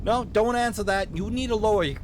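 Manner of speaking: loud, high Minnesotan cop cutting in; hasty warning in a Minnesotan accent; vaguely Minnesotan accent. Acted. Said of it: "give him a vaguely Minnesotan accent".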